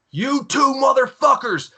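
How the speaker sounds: high, drawn-out imitated bellow; slow, high-pitched mock command; acted angry senior. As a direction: call out two culprits like a drill instructor. Senior orders recruits outside, retold.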